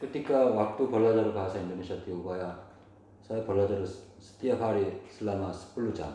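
Korean-accented Indonesian; foreigner speaking indonesian. Real, not acted.

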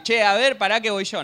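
loud, brash, matey shout; casual loud call. Retold or imitated. Pharaoh's daughter, as she would never say it, loud casual call. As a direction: bark it like a pushy commoner, not a princess. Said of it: "No le iba a decir".

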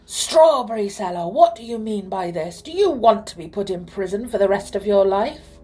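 loud, angry royal roar. A narrator's reading. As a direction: roar threats at the culprit. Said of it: "he roared at him".

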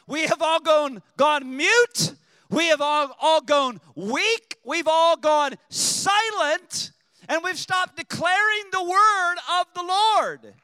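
loud, high and slow on the climax; shouted preaching. Real, not acted. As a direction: preach it at full force to the church. Preacher shouting his point.

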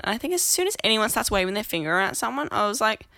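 normal talking voice

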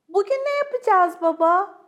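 put-on eager childlike question; excited little fox cubs. A narrator's reading. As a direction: ask it like kids hopping up and down. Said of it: "zıp zıp zıplayarak".

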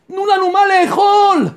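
high, pleading shout; imitating a desperate outcry; bitter cry. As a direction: cry out for food, pitched well up. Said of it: "והם צועקים בקול מר".

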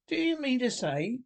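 high-pitched, incredulous outburst; excited. A narrator's reading. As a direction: challenge a friend in excited disbelief. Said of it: "cried the excited Rat".

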